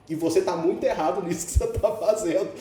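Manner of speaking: hammed-up preacher scolding the mugger; preaching. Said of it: "Já lançou a pregação". (sermão de brincadeira, subindo o tom)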